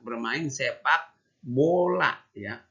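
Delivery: "Bermain sepak bola" slow and clear, "bola" falling; correct phrase. Shown as a demonstration. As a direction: say the whole right phrase slowly to the class. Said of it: "Sebenarnya itu kurang tepat".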